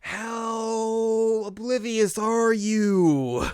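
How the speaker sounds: high, loud, hugely drawn-out wail; mock exasperation. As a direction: wail it slowly in comic disbelief.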